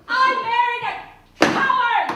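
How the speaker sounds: loud scornful cry of coward; angry shout. Acted. Wife yelling at husband she married a coward. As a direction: hurl the insult at him.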